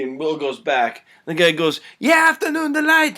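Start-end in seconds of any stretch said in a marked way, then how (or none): 2.0-3.2 very high, loud yelling; enraged shout. Acted. Hypnotist attacking Will in anger, enraged shouting. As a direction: scream it in a frenzy.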